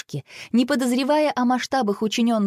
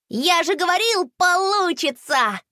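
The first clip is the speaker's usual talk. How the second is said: put-on high, jubilant boy's exclamation; gleeful, high-pitched triumph; delighted; 10 semitones higher than usual. A narrator's reading. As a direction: crow it happily, stretching the last word. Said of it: "обрадовался Рома".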